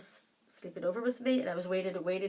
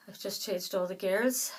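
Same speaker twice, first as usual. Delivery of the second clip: ordinary talk, nothing marked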